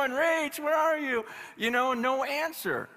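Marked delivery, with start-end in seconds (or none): 0.0-1.2 calling out. Retold or imitated. Dad calling out for daughter.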